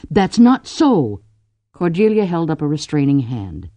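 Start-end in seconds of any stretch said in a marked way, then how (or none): 0.1-1.2 high, loud, drawn-out protest; object, stretching the words; indignant. A narrator's reading. Drew defends her family to Ku, indignant. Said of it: "said Drew indignantly".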